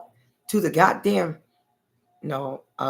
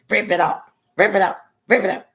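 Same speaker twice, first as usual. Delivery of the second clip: each rip it up louder and higher; mom ordering, building; 5 semitones higher, 6 dB louder than usual. Retold or imitated. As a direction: escalate the command into a yell. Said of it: "she acted just like that".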